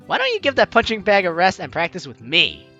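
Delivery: usual speaking voice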